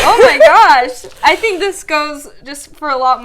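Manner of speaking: loud, emotional, near tears; overwhelmed, trying not to cry; teary-eyed. Real, not acted. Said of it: "teary-eyed".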